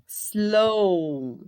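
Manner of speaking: "Slow" stretched very long on the O; drawn-out final O. Shown as a demonstration. Teacher showing the W is silent, lengthened O. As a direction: hold the vowel of "Slow" with no W sound. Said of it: "O som que teve do O com W foi de".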